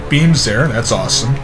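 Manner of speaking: ordinary delivery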